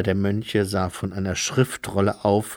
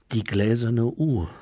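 usual speaking voice

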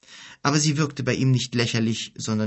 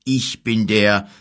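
slow, louder start that halts; hesitant; 7 dB louder, slower than usual. A narrator's reading. Old king introduces himself to the guest, hesitating. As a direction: begin weightily, then falter before the title. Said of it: "er zögerte kurz".

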